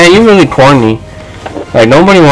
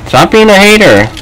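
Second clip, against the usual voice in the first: ordinary talk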